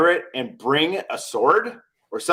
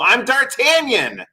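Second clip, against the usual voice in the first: haughty D'Artagnan claim; 10 semitones higher, 4 dB louder, slower than usual. Retold or imitated. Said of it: "and you go".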